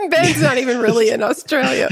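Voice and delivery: real laughter through the words; laughing. Real, not acted. Co-host giving away the Australia joke, laughing.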